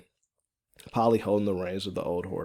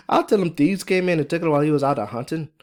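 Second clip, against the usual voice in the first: laughing reply; 7 semitones higher, 6 dB louder, faster than usual. A narrator's reading. Fiona plots a lie for her husband, laughing. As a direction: laugh first, then louder, higher, fast Irish brogue. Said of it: "Fiona replied with a laugh".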